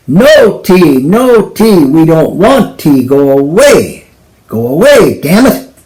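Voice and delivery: loud high yelling, fed up; angry shout. Acted. Husband shouting at the tea seller to go away, angry. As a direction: bellow it through the door.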